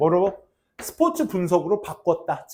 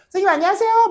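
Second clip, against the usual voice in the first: much higher, chirpy imitation of a lively girl; bubbly greeting; 13 semitones higher, 3 dB louder, much faster than usual. Imitating a peppy student greeting the teacher, excited. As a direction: bounce in like a cheerful student. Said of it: "막 이러고 들어오는 애였단 말이야".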